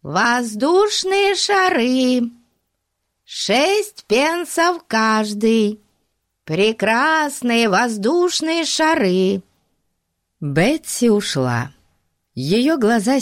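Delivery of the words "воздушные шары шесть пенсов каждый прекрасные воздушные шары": put-on slow, high cracked old street-seller call. A narrator's reading. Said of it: "закричал старческим, надтреснутым голосом".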